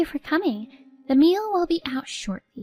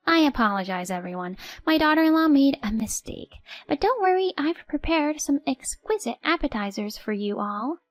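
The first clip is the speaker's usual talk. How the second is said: fake apology; 6 semitones higher than usual. Mother-in-law's fake apology.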